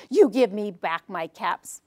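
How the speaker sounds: low, stern storybook demand; scolding peddler. A narrator's reading. Peddler demands caps back from monkeys, stern scolding. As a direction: scold them with a wagging-finger tone. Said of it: "shaking his finger".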